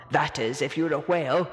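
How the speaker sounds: deep, wry whale voice; a whale's dry little joke to himself; low whale voice. A narrator's reading.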